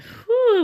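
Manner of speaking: a sharp high whew of disbelief; high loud whew. Real, not acted. Recalling the pain.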